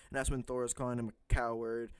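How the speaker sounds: ordinary delivery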